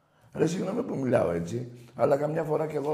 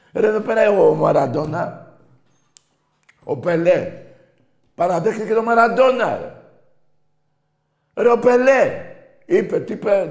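much higher and louder, screaming about Maradona and Pelé; real on-air screaming rant; furious shout; 9 semitones higher, 11 dB louder than usual. Real, not acted. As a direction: bellow at the listeners, losing control. Host screaming at rival fans.

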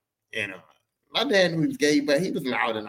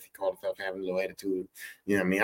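usual speaking voice

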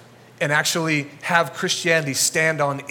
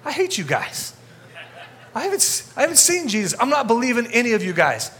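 doubting Thomas; 6 semitones higher than usual. Retold or imitated. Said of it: "And Thomas is like".

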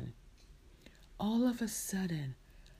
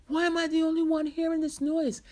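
high, loud, hurried question; exasperated wail; 10 semitones higher, 6 dB louder, faster than usual. Acted. Marcia asking herself about the noise, exasperated. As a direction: cry out in baffled frustration.

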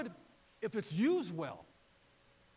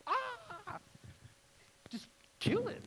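mock spider panic; 11 semitones higher, much slower than usual. Mocking someone afraid of spider.

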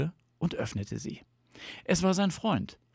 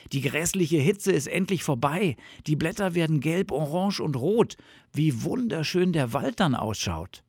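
higher-pitched rapturous fox voice; enthusing fox; 6 semitones higher than usual. A narrator's reading. Fox praises autumn to Bear, enthusing. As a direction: gush in a raised, lilting pitch. Fox's voice in the story.

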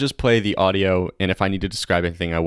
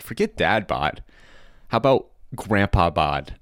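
normal talking voice

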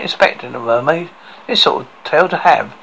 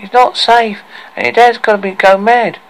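put-on higher, boyish worried turtle; a nervous young boy fretting; Torty's boyish voice; 6 semitones higher, 4 dB louder than usual. A narrator's reading. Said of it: "with a boyish voice".

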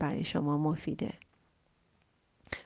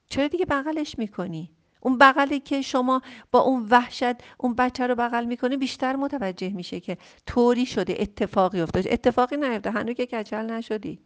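higher and louder, pressing the question; emphatic higher challenge; 4 semitones higher, 5 dB louder than usual. Shown as a demonstration. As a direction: push back hard, rising in pitch. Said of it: "higher louder repeat". (پرسش تند با صدای بلندتر و زیرتر)

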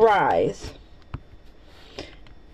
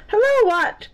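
calling out; 11 semitones higher than usual. A narrator's reading. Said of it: "called Mister Alden".

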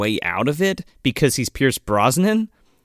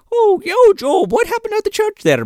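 very high, loud put-on call; nosy heckler; 4 dB louder than usual. [name] calls to Job about the church, high mocking holler.

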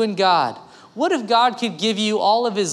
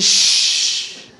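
hushing shh; 3 dB louder than usual. Retold or imitated. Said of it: "And the lion says".

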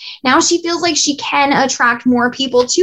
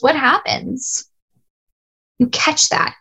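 ordinary talk, nothing marked

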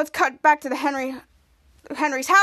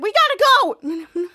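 urgent call; 5 semitones higher, 5 dB louder, much slower than usual. Retold or imitated. Captain Man urging them out.

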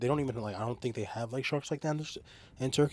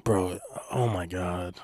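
slow disbelief; 5 semitones lower, 5 dB quieter, much slower than usual. Real, not acted. Reaction to wheelchair wish.

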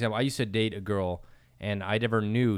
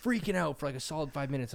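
ordinary delivery

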